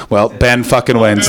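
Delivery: usual speaking voice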